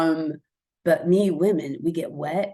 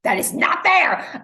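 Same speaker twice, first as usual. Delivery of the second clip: high, loud protest; mock outrage; 6 semitones higher, 8 dB louder than usual. Woman protesting cute laughs across the ocean, loud mock outrage. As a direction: punch it out in loud mock indignation.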